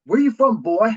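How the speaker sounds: loud, high imitated challenge; mock challenge. Guest mimics military folk asking his origins, mock challenge. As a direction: call it out like someone sizing up a stranger.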